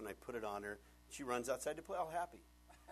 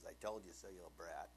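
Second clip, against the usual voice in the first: low, soft mock scolding; muttered told-you-so; 8 semitones lower, 8 dB quieter than usual. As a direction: drop down low and scold under your voice. What God never comes in saying.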